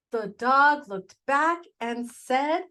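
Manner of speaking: ordinary delivery, nothing marked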